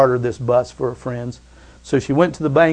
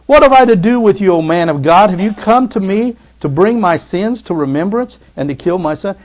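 accusing widow; 6 semitones higher, 7 dB louder than usual. Acted. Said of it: "she said to Elijah".

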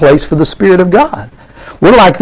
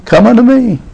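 mild retold shout; 7 semitones higher than usual. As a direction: lightly imitate a shouted summons. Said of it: "Jesus shouting?".

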